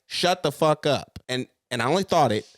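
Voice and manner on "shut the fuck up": slow, louder, higher hissed-out anger; retold snap. Mother retelling snapping at her crying newborn, slow and angry. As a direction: spit it out through frustration.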